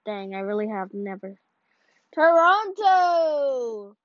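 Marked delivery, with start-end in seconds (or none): 2.1-4.0 a long, loud, excited call of the city name; joyful drawn-out exclamation; excited drawn-out cry. Real, not acted. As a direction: stretch it out slowly and louder, thrilled. Excited at finding Toronto.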